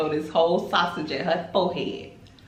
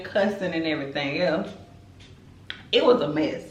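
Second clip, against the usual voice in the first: normal talking voice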